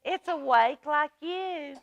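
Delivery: lightly voiced big mouse calming a small one; reassuring Big Mouse. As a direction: soothe a scared little one. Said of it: "He said".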